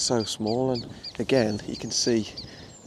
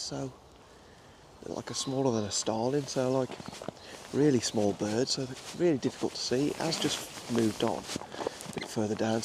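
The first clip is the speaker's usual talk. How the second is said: hushed commentary; 7 dB quieter than usual. Real, not acted. Narrating close to the woodpecker, softly hushed. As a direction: keep it down so the bird stays put.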